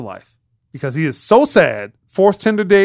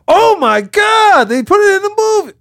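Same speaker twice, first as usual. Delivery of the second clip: mock fan freakout; 4 dB louder than usual. Podcaster mimicking fans thrilled by the movie, high mock freakout. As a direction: squeal it like an overexcited fan.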